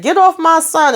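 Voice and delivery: high, sharp yell of a mock protective dad; angry father. Father shoving Ern off his son, high angry yell. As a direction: snap it out like a dad defending his kid.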